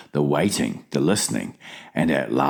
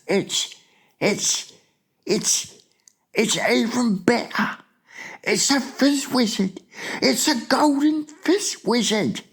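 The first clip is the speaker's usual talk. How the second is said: high, hushed, stammering excitement; thrilled whisper. A narrator's reading. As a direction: build from halting wonder to delight. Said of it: "with a thrill in his voice".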